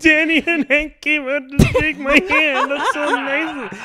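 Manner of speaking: high mock-tearful voice; Ryan Smith crying. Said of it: "is in the back crying".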